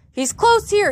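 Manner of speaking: high, urgent cry. A narrator's reading. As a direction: call out a warning to the others. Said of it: "he cried".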